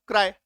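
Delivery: "क्राई" said in a single beat; "क्राई" as one undivided syllable. Shown as a demonstration. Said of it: "एक ही बार में".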